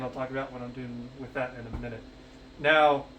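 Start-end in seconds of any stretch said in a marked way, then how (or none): 0.0-2.0 a throwaway rushed repeat of "I'll talk about what I'm doing with that in a minute"; fast, softer deadpan echo of the same line; quick quiet repeat. Shown as a demonstration. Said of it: "And again".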